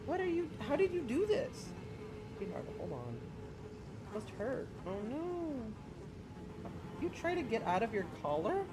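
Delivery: high, soft coo to a tangled cat; soothing pet talk. Real, not acted. Streamer gently fussing over his trapped cat, soft and high. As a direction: coo softly and worriedly at a hurt pet.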